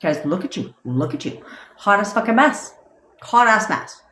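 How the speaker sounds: high, fast exasperated put-on voice; mock the fed-up partner; lightly voiced partner griping at you; imitating the partner. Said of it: "and then they're like".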